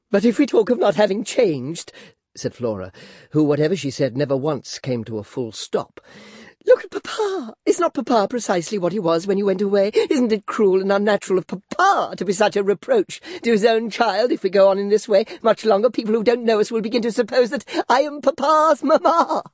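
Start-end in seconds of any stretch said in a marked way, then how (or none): 0.1-1.9 put-on high, girlish, fluttering chatter; coquettish Flora. Flora fishing for compliments from Clennam, high and girlish. 6.6-19.4 put-on high, girlish, fluttering chatter; coquettish Flora. Flora fishing for compliments from Clennam, high and girlish.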